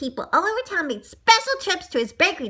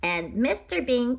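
ordinary delivery, nothing marked

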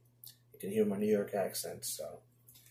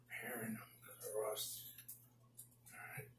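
whispering; 16 dB quieter, faster than usual. Real, not acted. Muttered complaint while working.

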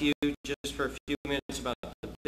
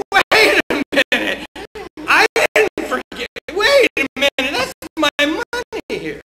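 loud, higher, indignant objection; outraged protest; 9 semitones higher, 15 dB louder than usual. Retold or imitated. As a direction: shout your protest at the judge. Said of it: "You say".

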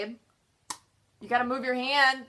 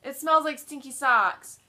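drawn out, louder and slower; slow disgusted complaint; 4 semitones higher, 8 dB louder, slower than usual. Real, not acted. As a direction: say it slowly in loud disgust.

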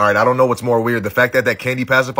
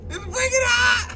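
drawn-out desperate shout; slower than usual. Real, not acted. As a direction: yell it out, slow and strained. Burning from the chip challenge.

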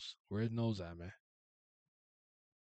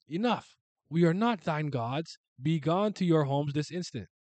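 higher, louder, slow divine command; stern god; 6 semitones higher, 10 dB louder, slower than usual. Acted. God dismissing the humans, loud and stern. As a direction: order them home with full authority.

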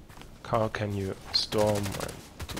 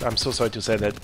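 ordinary talk

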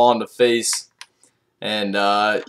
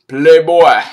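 louder punchline call; hyped up; 3 semitones higher, 6 dB louder than usual. Real, not acted. Vlogger riffing on Quincy's name, louder hype call. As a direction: bark out the nickname, pumped.

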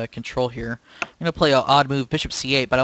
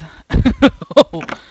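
high giggling fit; 10 semitones higher, 8 dB louder than usual. Real, not acted. Still laughing after win.

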